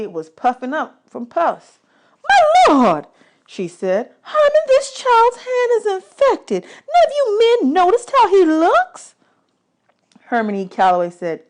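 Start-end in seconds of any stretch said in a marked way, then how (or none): 2.3-3.1 very high loud cry of alarm; alarmed exclamation. Acted. Singer seeing the infected hand, loud alarmed cry. 4.2-9.1 much higher, louder, slow indignant rebuke; indignant scolding. Acted. Singer scolding the band about the boy, loud and high.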